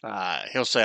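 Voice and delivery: normal talking voice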